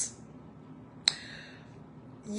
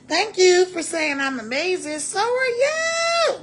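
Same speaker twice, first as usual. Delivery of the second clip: high, drawn-out delighted cry; excited thanks; 8 semitones higher, 4 dB louder than usual. Real, not acted. Thanking a viewer who called her amazing, delighted high cry. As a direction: gush back at the viewer who complimented her. Reading live comments.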